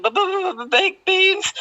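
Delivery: high, stammering sob through the words; blubbering. Retold or imitated. Crying to the lady over baked beans, stammering high. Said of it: "And I was blubbering".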